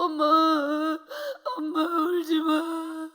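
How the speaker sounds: slow, tearful plea to mom; crying child. Retold or imitated. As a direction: beg her mother through tears. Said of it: "우리는 이렇게 같이 울었는데". (울면서 엄마를 달래는 아이)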